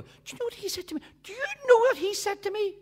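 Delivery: rising outrage; indignant building. Acted. Indignant teacher repeats herself.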